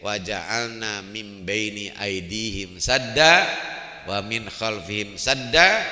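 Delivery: slow, loud chanted recitation of "Wa ja'alna mim baini aidihim sadda"; Quran recitation. Acted. Preacher recites the Prophet's verse to the congregation, chanted slow and loud. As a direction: draw out "sadda" as a sung verse.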